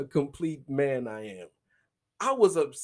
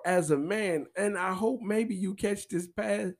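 ordinary delivery

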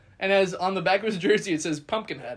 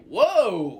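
startled whoa; 2 semitones higher, 5 dB louder than usual. Retold or imitated. Startled reaction to aggressive fans.

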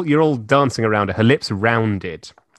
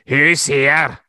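louder, sharp demand; 5 semitones higher, 6 dB louder than usual. Acted. Reading the hero's line, loud demand. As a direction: bark the question at the room. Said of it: "he demanded".

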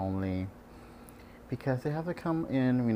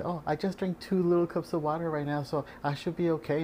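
higher, naive patient voice; lightly mocking patient impression; 7 semitones higher than usual. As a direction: mimic a clueless patient. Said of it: "I had one patient say".

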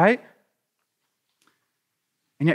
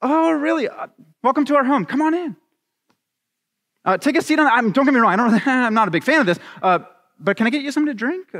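high-pitched, hammed-up welcome to an intruder; mock polite host; 8 semitones higher than usual. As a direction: act the far too accommodating husband, voice pitched up. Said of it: "let's try something on".

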